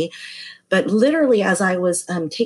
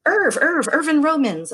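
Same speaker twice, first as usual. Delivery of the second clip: much higher, eager self-introduction; insistent name repeat; 11 semitones higher than usual. Retold or imitated. Client repeating his name to the puzzled masseuse, high and eager. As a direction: repeat your name, hoping it rings a bell.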